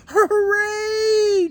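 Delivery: very high cheer; hooray at full pitch. Acted. Mice cheering the challenge, very high hooray. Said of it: "cheered the Mighty Mites".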